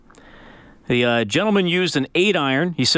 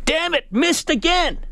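mock frustrated cry; 13 semitones higher than usual. Golfer missing short putt once more, hammed-up frustration. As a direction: draw out the golfer's mock outburst.

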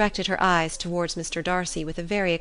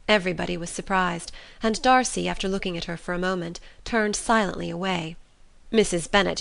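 usual speaking voice

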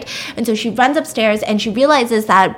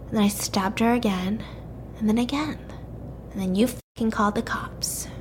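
creepy whisper; 7 dB quieter than usual. Acted. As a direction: whisper it like a cold killer confiding. Killer confessing in a quiet voice.

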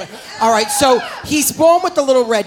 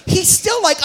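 ordinary talk, nothing marked

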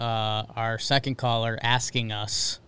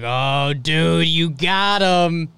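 drawn-out, higher mock hype; mock excited fans; 3 semitones higher, 4 dB louder, much slower than usual. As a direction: stretch it out like imitated hyped-up fans. Retelling fans' excited reaction.

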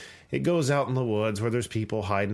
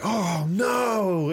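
high, hammed-up scared cry; mock fright; 9 semitones higher, 4 dB louder than usual. Tractor driver playing along, high mock-scared cry. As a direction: play up the fear like a driver in on the act.

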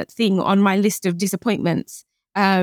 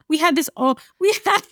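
high-pitched, breaking into laughs mid-sentence; real laughter; 9 semitones higher than usual. Real, not acted. Guest recalls the absurd argument with husband, laughing. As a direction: try to get the words out through laughter.

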